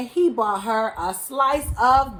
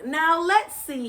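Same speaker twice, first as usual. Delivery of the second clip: higher, musing to himself; pondering; 7 semitones higher than usual. A narrator's reading. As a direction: think it over aloud. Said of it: "he pondered".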